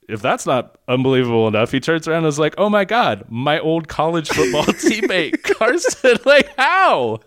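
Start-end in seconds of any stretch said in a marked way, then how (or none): 2.6-6.2 overdone excited recognition; hammed-up gushing greeting. Imitating the doctor's greeting. 6.3-7.2 high, loud disbelief; can't believe it; incredulous high how. Real, not acted. Incredulous laughing reaction.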